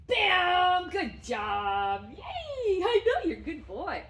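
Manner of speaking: bursting, high, loud praise for the dog; squealing delighted dog praise; excited praise. Real, not acted. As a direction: cheer him on like he just won. Praising dog after trick.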